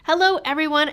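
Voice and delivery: ordinary talk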